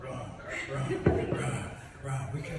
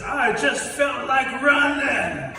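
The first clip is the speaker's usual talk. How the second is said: higher, louder, slow Forrest drawl; Forrest Gump; 9 semitones higher, 9 dB louder, much slower than usual. Acted. Forrest answers the animals: high slow loud drawl. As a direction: draw it out simply like Forrest. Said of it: "Forrest says".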